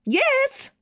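yes jumps way up; emphatic high yes. A narrator's reading. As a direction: sing out the yes. Narrator's emphatic answer.

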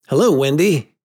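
breezy hello, noticing nothing amiss; carefree boy. A narrator's reading. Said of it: "not noticing any difference".

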